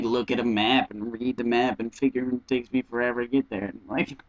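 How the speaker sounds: ordinary delivery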